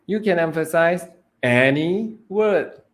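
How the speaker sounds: slow, with any punched high and loud; stress on ANY. Shown as a demonstration. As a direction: hit the word any. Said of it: "emphasizing different words".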